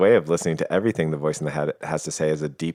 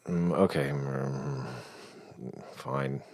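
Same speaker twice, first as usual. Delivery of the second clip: passive-aggressive, barely audible compliance; subtle fuck-you; 2 semitones lower, 8 dB quieter, much slower than usual. Acted. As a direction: mutter a reluctant okay, trailing down quieter. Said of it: "kind of like a subtle "fuck you".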